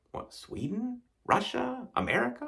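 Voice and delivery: high, unsure guesses, each one a question; puzzled guessing. Pretend. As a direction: grope for any country that fits. Quiz answer guesses voiced.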